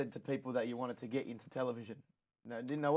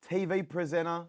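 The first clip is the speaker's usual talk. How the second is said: upbeat, loud podcast host intro; show host intro; 5 semitones higher, 8 dB louder than usual. Acted. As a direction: announce the guest with energy.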